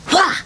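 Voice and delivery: sudden jump-scare yell; startling boo. Acted. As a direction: pop out to scare the viewer. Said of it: "Did I scare you?".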